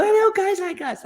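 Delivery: very high-pitched squeal; retold giddy shriek; excited mock scream. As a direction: squeal back in shared disbelief. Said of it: "and Dana started screaming".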